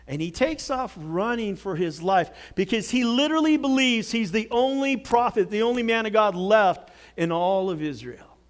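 usual speaking voice

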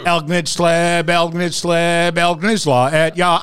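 slow, high [name] sung backwards; backwards speech. Pretend. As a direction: chant the backwards carol slowly. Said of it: "talking backwards".

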